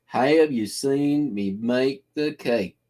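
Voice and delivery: slow Kentucky drawl, stretched vowels; slow, drawn-out Kentucky accent. Shown as a demonstration. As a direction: draw it out slow like a Kentucky local. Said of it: "it's a Kentucky accent".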